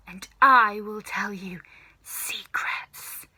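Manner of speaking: slow, luring witch whisper. Acted. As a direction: tempt him in a whisper. Grandma luring George.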